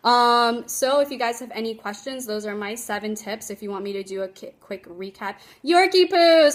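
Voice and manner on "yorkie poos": excited exclamation. Real, not acted.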